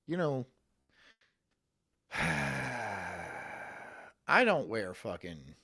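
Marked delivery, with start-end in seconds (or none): 2.1-3.8 a long, low, tired sigh; weary sigh before complaining. Real, not acted. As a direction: let out a heavy sigh. Weary about wearing suits.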